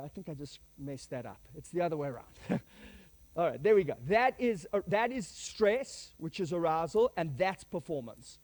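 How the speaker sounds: normal talking voice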